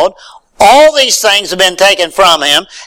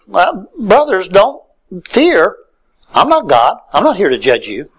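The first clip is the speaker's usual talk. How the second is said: gentle reassurance; 3 semitones higher than usual. Acted. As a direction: ease the brothers' fear gently. Joseph comforting brothers, retold.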